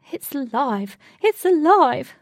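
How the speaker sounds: building to a high, loud cry; excited shout. A narrator's reading. Cat shouts while chasing the vine, thrilled. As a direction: shout it twice, far bigger the second time. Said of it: "shouted Blue as she chased the vine".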